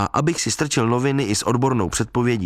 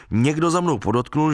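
ordinary delivery, nothing marked

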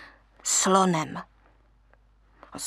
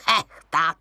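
hideous cackle; 5 semitones lower than usual. Acted. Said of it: "jak se příšerně chechtat".

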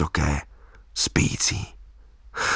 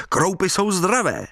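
high, jaunty call; cheerful call; 8 semitones higher than usual. A narrator's reading. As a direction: call it merrily, pitch well up. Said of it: "volal jsem vesele".

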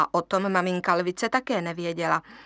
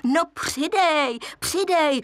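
higher, slow coaxing hyena voice; urging hyena; 6 semitones higher, 3 dB louder, slower than usual. A narrator's reading. Hyena urging the lion to laugh harder, higher. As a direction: push him to laugh louder.

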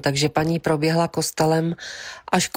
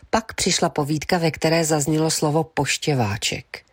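ordinary delivery, nothing marked

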